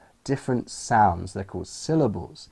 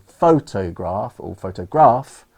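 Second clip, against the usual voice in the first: "photograph" with a full "toe" and misplaced heavy stress, then punched on GRAPH; louder, mis-stressed "photograph", ending hard on the last syllable; wrong word stress; 6 dB louder than usual. Shown as a demonstration. Teacher showing what would be wrong, misplaced stress. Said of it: "that would be wrong".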